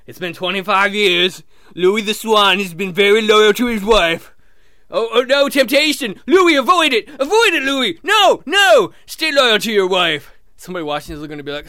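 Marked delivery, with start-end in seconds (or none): 0.1-4.3 loud, lightly voiced nature commentator; wildlife watcher. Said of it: "guy in the bushes who's like". 4.9-10.3 loud, high, panicked urging; alarmed pleading. Acted. Watcher pleading with the swan.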